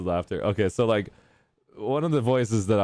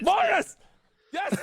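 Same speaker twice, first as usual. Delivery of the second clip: much higher, joyful shout of the name; excited call; slower than usual. Real, not acted. Greeting Morris whose mic finally works, an excited high call. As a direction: call his name out in delight.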